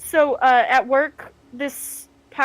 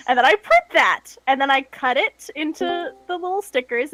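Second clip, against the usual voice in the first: ordinary talk, nothing marked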